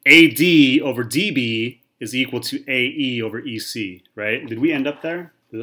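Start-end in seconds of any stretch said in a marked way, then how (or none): 0.1-4.0 slow, spaced-out letters in "A D over D B"; slowly out loud. Shown as a demonstration. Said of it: "say that again slowly out loud".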